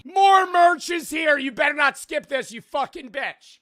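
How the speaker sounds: loud, high hype yell; hyped merch shout. Acted. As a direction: sell it like a screaming hype man.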